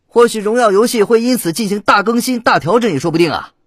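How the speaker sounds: high, loud TV commentator; Pan Lin, lighthearted. A narrator's reading. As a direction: chatter excitedly like a match commentator. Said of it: "潘林笑道，语态轻松".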